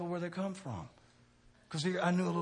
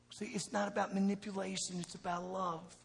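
ordinary talk

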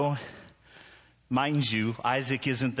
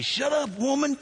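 high, raised mock outburst; mock angry retort; 3 dB louder than usual. Preacher answering his heckling wife, hammed-up outburst. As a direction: fire back in hammed-up exasperation. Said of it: "And I just say".

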